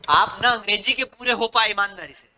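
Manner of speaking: ordinary talk, nothing marked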